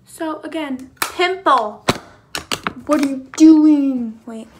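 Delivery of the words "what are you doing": slow, much louder outburst; drawn-out shouted disbelief; loud exasperated cry. Real, not acted. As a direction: bellow it at the mirror. Scolding her own pimple.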